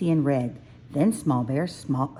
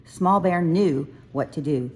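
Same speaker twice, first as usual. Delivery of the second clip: usual speaking voice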